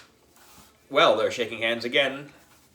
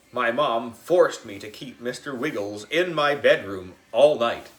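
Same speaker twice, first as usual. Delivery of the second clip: usual speaking voice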